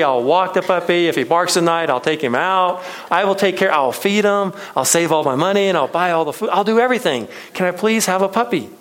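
put-on high, loud, eager kid pleading; child pleading with his mom, high and loud; begging child. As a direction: rattle off promises like a kid desperate for a yes. Boy begging mom for puppy.

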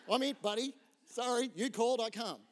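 a bit of hammed-up bravado; cheeky mock bravado. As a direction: sell it like a cocky superhero answering the call. Pastor jokes about his answer.

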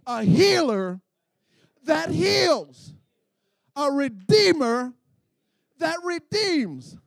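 short phrases with big pauses, punched loud; loud, swooping chant-like declaration; emphatic call-and-response litany. Shown as a demonstration. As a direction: preach it phrase by phrase for the crowd to repeat. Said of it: "I like to say it like this".

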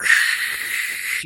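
long hissed sizzling noise; hot knife sizzle, made by mouth; sustained voiceless hiss; imitated sizzle. Imitating hot knife cutting flesh.

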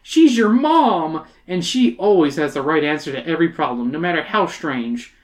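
dramatic, animated storybook reading; story narration. A narrator's reading. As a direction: narrate with big pitch swings, playing up the drama.

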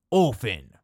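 put-on Orphan in a British accent, no r sound. Pirate King answers in a British accent. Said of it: "in a delightfully British dialect".

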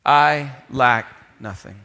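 slow, firm "I lack nothing" led for the congregation; slow unison recital. Real, not acted. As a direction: say "I lack nothing" slowly with the crowd. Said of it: "Could you say that with me?".

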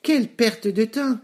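high-pitched mocking piglet voice. A narrator's reading. Piglet brothers jeering at their hard-working brother, mocking. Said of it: "se moquèrent de lui".

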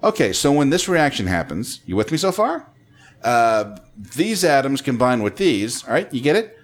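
loud, high, questioning lift on "far" and "get it"; mock explainer, every ending tipped up like "you get it?"; upward inflection. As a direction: check in after each phrase, rising on "far", "right", "it". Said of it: "it's a shorthand of".

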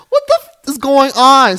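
what-the-hell shriek of shock; real stunned squeal; shocked outburst. Real, not acted. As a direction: voice shoots way up in disbelief. Outraged disbelief at Austin.

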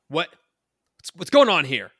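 loud startled guard shout. Acted. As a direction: yell it out in alarm. Guard yells at intruders.

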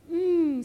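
happy "mmm" through a smile; smiling "mmm". A narrator's reading. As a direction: hum it with pleasure. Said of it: "smiled baby".

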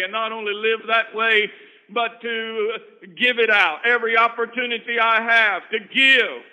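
loud, rising sermon delivery; building preacher's call; full-force preaching. Acted. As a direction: preach it out to the back row. Preaching to a congregation.

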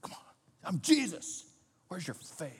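soft, haughty voice of a scolding Jesus; condescending Jesus. Shown as a demonstration. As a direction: talk down with superiority. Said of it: "That's the way I heard it".

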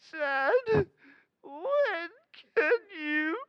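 put-on high, slow, sobbing kid voice; high, crying little boy with a petty plea; tearful child. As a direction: wail the request like a heartbroken boy.

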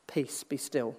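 very soft, slow and calm; hushed calm. Acted. Preacher voicing God calming his listeners, very soft. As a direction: lay it down gently, almost whispered.

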